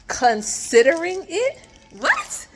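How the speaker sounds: high-pitched, baffled questioning; incredulous. Real, not acted. Incredulous at Airbnb's response.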